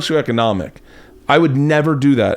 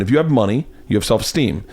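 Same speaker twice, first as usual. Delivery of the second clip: ordinary talk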